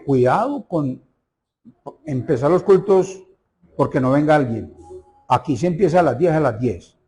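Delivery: ordinary talk, nothing marked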